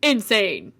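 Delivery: a sudden loud one-word exclamation. Real, not acted. To viewers about the game, a loud burst. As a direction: blurt it out loud.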